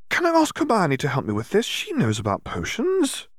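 put-on high, eager child's voice; a little sister pleading to be heard; excited young girl. A narrator's reading. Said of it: "Columba exclaimed".

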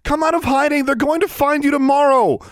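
very high, loud pleading yell; desperate yell. A narrator's reading. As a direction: yell down a corridor at someone hiding. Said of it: "she yelled".

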